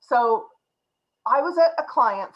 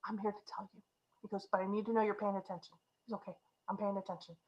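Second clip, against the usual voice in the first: hushed; 12 dB quieter, faster than usual. Retold or imitated. Ed leaning across the table to the manager, hushed and much softer. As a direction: lean in close and keep it down. Ed leaning close, speaking low.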